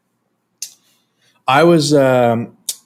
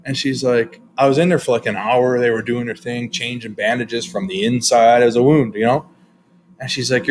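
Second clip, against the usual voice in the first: usual speaking voice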